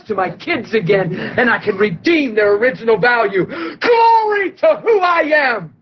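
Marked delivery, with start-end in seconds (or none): 3.5-5.7 exultant cry. Acted. God shouting his glory over the newborn, slow and exultant. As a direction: cry it out like God overjoyed at his Son's birth.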